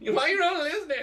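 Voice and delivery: very high, loud playful squawk; mock scolding through laughter; mock exasperation. Host teasing guest for not listening, mock exasperated.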